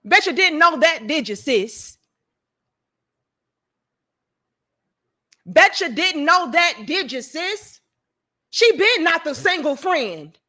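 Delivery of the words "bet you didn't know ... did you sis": taunting. Real, not acted. Taunting the accusers.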